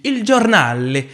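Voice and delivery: loud, high, drawn-out hammed-up Italian; caricature Italian accent. Narrator announcing the café's name, hammed-up Italian accent. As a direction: put on a mock Italian accent. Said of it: "faire cet accent italophobe".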